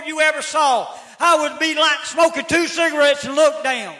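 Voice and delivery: high, loud preaching; pleading preacher's cry, loud and high; shouted preaching. Acted. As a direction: preach it at full force. Testimony preached at full volume.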